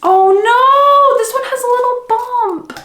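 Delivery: very high, dismayed wail; dismayed whine. Real, not acted. Vlogger finding a bump on a new book, dismayed. Finds damage on a prized book.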